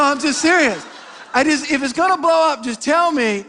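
usual speaking voice

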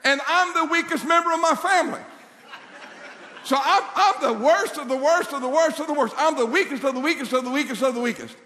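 high, mock-insecure pleading; insecure protest. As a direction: overwrought case for being the weakest. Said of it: "a little bit of an insecurity complex".

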